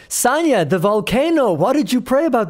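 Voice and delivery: high, hammed-up boss praising Sonia; mock boss voice. Host mimics the boss crediting Sonia's prayer, mock-high. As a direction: play the boss in a mock-high sing-song.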